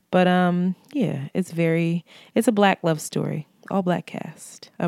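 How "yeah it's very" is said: usual speaking voice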